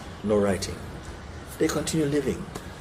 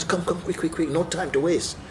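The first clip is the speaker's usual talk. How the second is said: hurrying widow; 6 semitones higher, 4 dB quieter than usual. Retold or imitated. Widow hurrying friend to the house.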